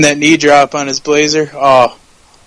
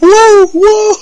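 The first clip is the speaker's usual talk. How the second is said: high, loud wooing whoop; Ric Flair imitation; 6 dB louder than usual. Fan quoting a favourite wrestling video, Flair imitation. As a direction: let out a high, ringing wrestler's woo. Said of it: "his Flair imitation is amazing".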